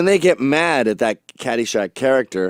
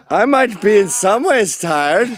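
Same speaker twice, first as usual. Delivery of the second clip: loud, high catchphrase; overblown, high-pitched political impression; mock candidate; 8 semitones higher, 5 dB louder than usual. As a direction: declaim it like a hammy politician. Repeated catchphrase of parodied candidate.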